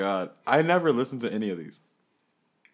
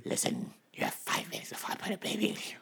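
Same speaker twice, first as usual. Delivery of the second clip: whisper; 8 semitones higher, 7 dB quieter, faster than usual. Acted. Teasing whispered threat.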